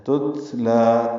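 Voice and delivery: تتلا with the final a drawn long; تتلا said slowly, vowel stretched; lengthened vowel. Shown as a demonstration. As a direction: hold the lam vowel in تتلا. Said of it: "دو حرکات لمبا ہے".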